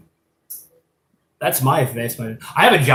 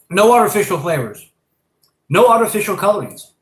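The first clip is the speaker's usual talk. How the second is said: higher, louder commercial-announcer voice; mock ad read; 7 semitones higher, 6 dB louder than usual. Pitching candy to viewers, loud announcer voice.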